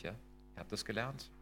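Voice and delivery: ordinary talk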